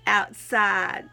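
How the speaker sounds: slow, emphatic recitation with measured pauses; slow inspirational poem reading; devotional reading. Acted. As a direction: read your testimony aloud, phrase by phrase. Reads written devotional piece aloud.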